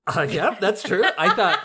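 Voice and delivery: talking through laughter; words breaking into laughs; laughing. Real, not acted.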